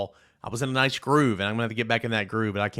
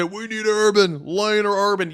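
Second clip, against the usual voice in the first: mocking Florida fans; 8 semitones higher, 5 dB louder than usual. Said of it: "Florida fans who say".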